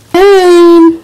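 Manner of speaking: high, loud cry of her name; desperate shout. Acted. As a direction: scream her name in alarm. Finds Jane, cries out.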